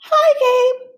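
put-on high, sweet little girl. A narrator's reading. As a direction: chirp it like a tiny sister. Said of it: "Stella said".

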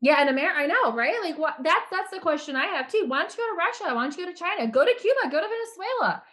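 rising exasperation, firing off each demand; indignant outburst. Real, not acted. Indignant about praising communism.

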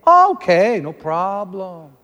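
mock eager deference, stretched out; suddenly obliging. Staff suddenly deferring, acted.

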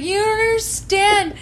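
high, loud, drawn-out wail; re-enacted fan outburst, words stretched out; excited protest. Excited fan to unimpressed companion at the movie, excited protest.

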